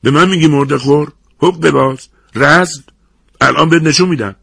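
loud, high angry outburst; furious, spoiling for a fight; enraged threat. A narrator's reading. Offended man threatens before grabbing the narrator.

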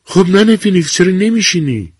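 bright-eyed recognition, chatty to a colleague; nurse voice. A narrator's reading. Nurse addresses old woman.

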